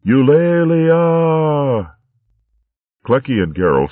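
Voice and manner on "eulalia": long, slow, high and loud shout; battle cry. A narrator's reading. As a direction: ring it out across the glade. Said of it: "battle cry of hares and badgers rang".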